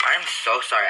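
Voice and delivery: ordinary talk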